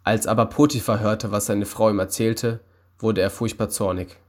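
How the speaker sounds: ordinary delivery, nothing marked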